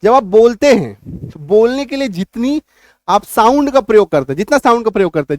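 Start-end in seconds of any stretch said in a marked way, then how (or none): none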